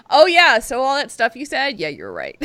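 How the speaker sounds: high, loud, dismissive yeah; mock agreement. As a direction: brush it off with fake agreement. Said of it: "It's like".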